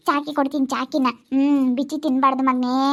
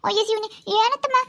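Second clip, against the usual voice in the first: high cooing comfort; 8 semitones higher than usual. Real, not acted. Grandmother coos high to her crying granddaughter. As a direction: soothe the crying little one, high up.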